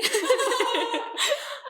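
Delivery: high, bubbling burst of laughter. Real, not acted. Cracking up as the friends joke around, high laugh.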